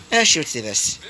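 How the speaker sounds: usual speaking voice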